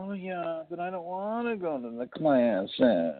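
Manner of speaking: put-on high, slow nasal whine of a spoiled kid; whiny voice. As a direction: whine it out like an entitled teenager. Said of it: "that's my whiny voice".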